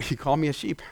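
low, soft answer; settled reply. Acted. Believer who hears it right, low soft reply. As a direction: drop right down, quiet and sure.

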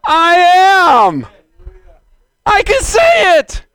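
high, loud exultant cry; triumphant proclamation. Acted. Preacher claiming the words before Abraham was, exultant shout. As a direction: cry it out with full conviction.

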